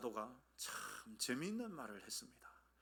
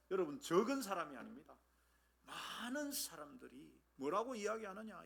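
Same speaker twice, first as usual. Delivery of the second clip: normal talking voice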